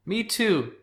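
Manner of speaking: very high, wailed reply; overwrought, high-pitched admission; emotional outcry. A narrator's reading. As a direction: blurt it out mid-fight. Brother cries it out mid-fight.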